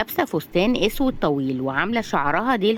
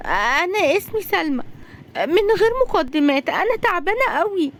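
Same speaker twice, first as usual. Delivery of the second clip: high-pitched tearful voice; acted high, distressed crying; crying in terror; 10 semitones higher than usual. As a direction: wail it like a frightened, weeping girl. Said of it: "دخلت بنت بتعيط برعب".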